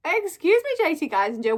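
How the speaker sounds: hammed-up offended excuse me; mock outrage. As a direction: call the author out in fake shock. Mock outrage at author's skill.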